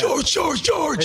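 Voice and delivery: high, carrying yell of a name; obnoxious yelling. Retold or imitated. Fans yelling to a player from the stands. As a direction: call him out across the stands. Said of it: "We obnoxiously yelled".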